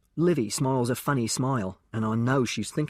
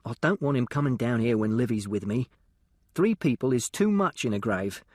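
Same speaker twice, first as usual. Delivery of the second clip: usual speaking voice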